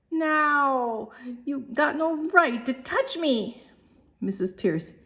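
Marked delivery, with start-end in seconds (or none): 0.1-3.6 high, slow whimpering protest; a hurt Cockney girl whimpering. Acted. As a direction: whine it near tears.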